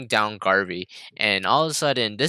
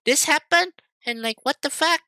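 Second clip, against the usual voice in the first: imitating mom scolding; 10 semitones higher than usual. Mimicking mom lecturing about driving, high ranting voice. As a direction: rant like an exasperated mom. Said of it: "she's like".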